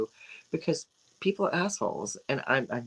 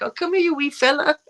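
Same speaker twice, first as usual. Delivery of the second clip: much higher, louder Scottish coo to a little monkey; cooing Scottish imitation; 10 semitones higher, 5 dB louder than usual. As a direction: call the wee fella over like a doting Scot. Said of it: "They be like".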